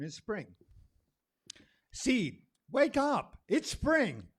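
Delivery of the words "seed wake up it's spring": high, loud call to wake the seed. Acted. Poet calling the seed to wake, high ringing shout.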